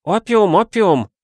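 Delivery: put-on high, loud, excited boy's voice; thrilled little boy shouting it out; eager nodding boy. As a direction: burst out gleefully, way up high. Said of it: "закивал китайчонок".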